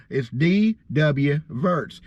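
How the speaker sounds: D W Verts said slowly, each letter given weight; slow down and give D and W full weight; D W Verts spelled out. Shown as a demonstration. Showing the audience the careful way to say D W Verts. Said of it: "D W obviously takes effort to say".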